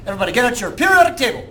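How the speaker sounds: fast, loud salesman pitch; car salesman. Acted. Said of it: "Do I sound like a car salesman?".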